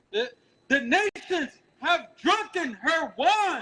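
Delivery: high, slow, ringing scripture reading; street-preaching Bible reader; scripture declamation. Acted.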